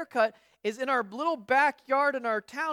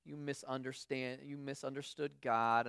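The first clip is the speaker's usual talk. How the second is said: put-on talking down to a little kid, lower and softer; condescending to a child; 9 semitones lower, 7 dB quieter than usual. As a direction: lower and much softer, patronising. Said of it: "you kinda say".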